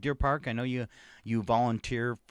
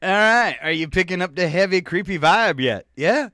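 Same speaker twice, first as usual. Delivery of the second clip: spooky teasing; 5 semitones higher, 7 dB louder than usual. Acted. Spooky playful tease.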